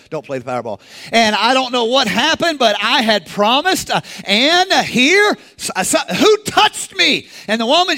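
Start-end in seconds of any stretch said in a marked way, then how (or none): 1.1-7.3 rising higher and louder, flustered; puzzled Jesus. Acted. Preacher voicing Jesus searching the crowd, rising puzzlement. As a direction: build from wonder to a loud question. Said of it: "Jesus is like".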